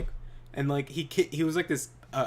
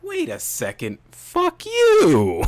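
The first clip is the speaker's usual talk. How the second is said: click, then blast it out; laughing mock outburst; sudden realization yell; 13 dB louder, much slower than usual. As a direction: start plain, then explode higher and much louder. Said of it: "this brain blast".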